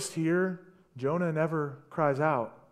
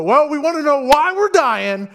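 high, loud panicked sailors' voice; frantic sailors; 8 semitones higher, 11 dB louder than usual. Acted. Sailors in the storm deciding to cast lots, loud and high. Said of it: "And the sailors go".

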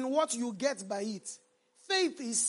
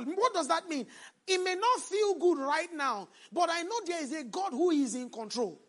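rising, emphatic sermon delivery with loud peaks; full-force preaching; 5 semitones higher, 6 dB louder than usual. Acted. Preacher urging his congregation, loud emphatic preaching. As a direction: preach it out to the church.

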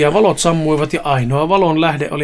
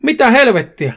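high-pitched startled exclamation; acted burst of surprise; astonished shout; 7 semitones higher, 4 dB louder than usual. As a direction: cry out in disbelief. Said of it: "Sitten kuului hämmästynyt huuto".

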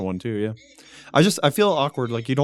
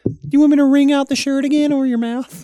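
high, loud, sugary lunch lady voice; mock lunch lady; 14 semitones higher, 8 dB louder than usual. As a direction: play the cafeteria lady sweetly offering something gross. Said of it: "She's like".

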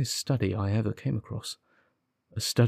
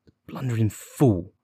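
mild bitter outburst. Acted. Detective fuming about the constable on the drive home, bitter. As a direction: spit it out with understated scorn.

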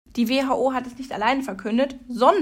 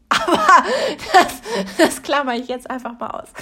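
laughing; 4 semitones higher, 7 dB louder than usual. Real, not acted. Laughing at herself for talking alone.

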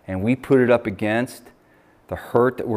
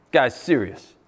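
usual speaking voice

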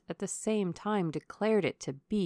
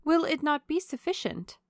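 high, loud, hopeful question; eager; 9 semitones higher, 5 dB louder than usual. A narrator's reading. Young lady pressing the colonel to stay, eager. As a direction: plead brightly in a lifted voice. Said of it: "said Marianne eagerly".

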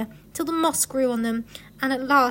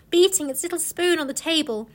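plain reading voice

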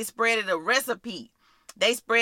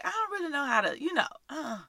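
mildly hesitant, higher and softer; unsure; 5 semitones higher, 4 dB quieter, faster than usual. Acted. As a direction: act a little lost for words. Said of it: "you might be like".

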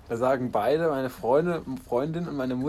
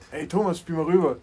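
raised voice calling to a teammate; mock call for a pass, higher and louder; imitated player's call; 5 semitones higher, 5 dB louder than usual. Imitating players calling each other.

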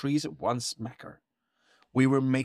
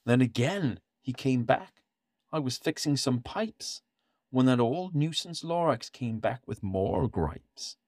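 plain reading voice

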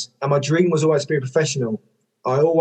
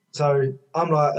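ordinary talk, nothing marked

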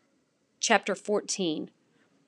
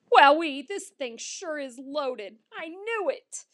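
high, gleeful wowee; high-pitched, drawn-out delight; delighted amazement; 6 semitones higher, 5 dB louder, slower than usual. A narrator's reading. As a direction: marvel like a pup at a feast. Dog delighted by full backpack.

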